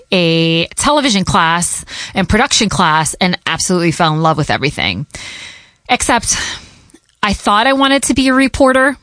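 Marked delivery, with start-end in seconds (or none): none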